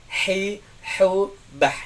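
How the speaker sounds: "hi, hu, bah" with a breathy h pushed from mid-throat; teacher drills the ha letter for students; Arabic ha demo. Shown as a demonstration. Teacher drilling ha, breathy throat h. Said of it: "Kedua adalah ha".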